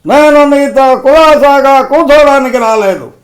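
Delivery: high, loud defiant film-hero shout; full-force dramatic declamation; Krishna as Alluri Sitarama Raju. Acted. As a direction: thunder it out like a rebel hero. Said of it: "Krishna గారు నటించారు climax సన్నివేశం".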